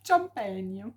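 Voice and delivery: "champagne" stretched out with a long final syllable; playfully sounded-out word. Pretend. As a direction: proudly show you can say "champagne". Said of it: "ça, j'arrive à le dire".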